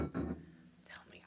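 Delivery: private whispered prompt; whispered aside. Real, not acted. Medium prompting the spirit, whispered aside. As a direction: whisper it to someone unseen.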